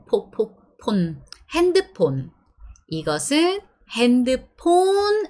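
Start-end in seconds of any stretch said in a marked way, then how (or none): 0.1-1.2 폰 said with a plain p, not an f. Shown as a demonstration. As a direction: say po, po, 폰 with a p sound. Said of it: "so we just pronounce it po".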